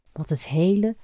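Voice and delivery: ordinary delivery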